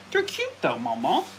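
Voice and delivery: squeaky high pet talk; baby talk to pet. Pretend. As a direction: coo at the dog in a tiny voice. Pet voice to a dog.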